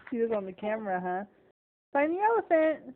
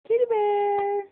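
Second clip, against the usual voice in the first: high, held-out pet-name call; singsong calling; 7 semitones higher, 4 dB louder, slower than usual. Real, not acted. Mother calling her distracted toddler by pet name, singsong. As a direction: coax her attention with a sustained high call.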